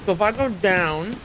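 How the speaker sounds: talking through a mouthful of cheese; chewing while talking; mouth full. Real, not acted. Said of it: "Sorry for talking with my mouth full of cheese".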